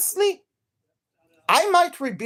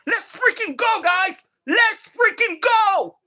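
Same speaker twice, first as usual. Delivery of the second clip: much higher, stretched hype cheer; freaking excited; 10 semitones higher, slower than usual. Real, not acted. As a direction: pump up the stream crowd. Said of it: "I'm so freaking excited".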